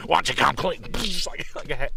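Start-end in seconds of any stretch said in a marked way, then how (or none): 0.1-0.8 tough interrogator. Retold or imitated. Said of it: "It's like".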